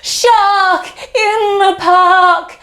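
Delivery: shouted warning. Acted. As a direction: shout it till fit to bust. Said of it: "He shouts".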